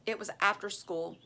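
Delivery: ordinary delivery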